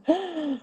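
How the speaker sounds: sharp sudden intake of breath, soft; shocked gasp. Retold or imitated. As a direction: gasp in disbelief. Reacting to controlling host.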